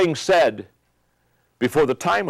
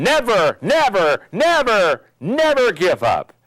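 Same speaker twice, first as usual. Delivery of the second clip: rising, ringing Churchill rallying cry; Churchill quote; 6 semitones higher, 3 dB louder, slower than usual. Retold or imitated. Speaker quoting Churchill to a crowd of leaders, higher rallying cry. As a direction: proclaim it like Churchill rallying a nation. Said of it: "It's Churchill saying".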